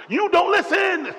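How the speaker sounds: shrieking, high and loud mock yell; mock yell at sister. Pastor imitating himself yelling at his sister, high shrill shout. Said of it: "my favorite line to my sister is".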